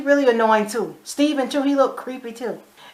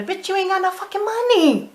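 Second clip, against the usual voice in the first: high-pitched incredulous jeer; mocking outburst; 10 semitones higher than usual. Viewer talking back to character wanting a lawyer, high mocking outburst. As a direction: scoff at them, voice jumping way up.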